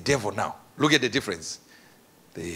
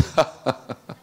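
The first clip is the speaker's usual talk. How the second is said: acted laugh; 4 semitones lower than usual. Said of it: "And he's just laughing".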